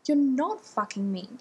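loud, angry denial; Rodney shouting at Ronan in a fight; shouted. A narrator's reading. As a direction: shout it back at him. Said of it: "Rodney shouted".

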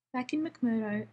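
ordinary delivery, nothing marked

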